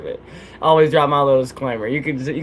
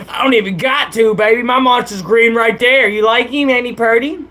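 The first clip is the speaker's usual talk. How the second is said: gloating; 6 semitones higher, 3 dB louder than usual. Real, not acted. Gloating over his green monster.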